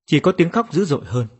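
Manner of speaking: usual speaking voice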